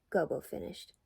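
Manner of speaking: ordinary delivery, nothing marked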